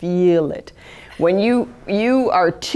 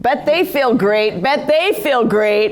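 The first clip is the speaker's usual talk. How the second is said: drawn-out, higher mock delight; mock gushing; 5 semitones higher, slower than usual. Tired empath on the people she helped, mock gushing. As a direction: stretch it into an exaggerated refrain. Mock-repeats others feeling great.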